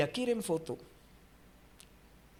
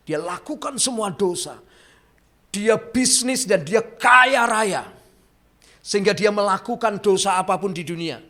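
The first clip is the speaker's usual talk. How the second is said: louder, rising preacher's cry; forceful preaching; 7 semitones higher, 9 dB louder than usual. Acted. Preacher tells the congregation of a sinner's wealth, loud and driving.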